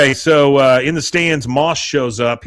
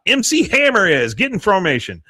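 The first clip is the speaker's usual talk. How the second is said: hype call; 8 semitones higher than usual. Retold or imitated. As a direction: announce it like a hype man. Coach hyping squad.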